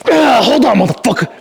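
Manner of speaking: high, loud grunting shouts; straining shouts. Real, not acted. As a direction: bark each rep out under the weight. Yelling through heavy reps.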